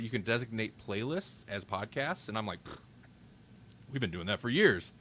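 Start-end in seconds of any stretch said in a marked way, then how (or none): none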